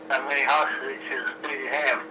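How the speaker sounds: usual speaking voice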